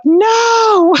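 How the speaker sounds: high wailing no over lost videos; a sudden anguished wail; anguished cry of no. Retold or imitated. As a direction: cry out in mock despair. Despair after deleting all videos.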